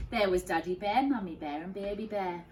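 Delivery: ordinary delivery, nothing marked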